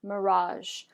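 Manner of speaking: mirage, stretch the final zh; model word mirage. Shown as a demonstration. Teacher models mirage for students to repeat. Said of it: "go ahead and say mirage".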